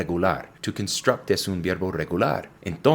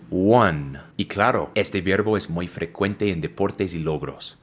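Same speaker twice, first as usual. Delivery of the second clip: ordinary talk, nothing marked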